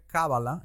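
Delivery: "Kabbalah" stressed on the first syllable, KA-ba-la; esdrújula stress. Shown as a demonstration. Host correcting guest on written form, "Kabbalah" as KA-ba-la. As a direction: punch KA in "Kabbalah", light ending. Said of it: "es una palabra esdrújula".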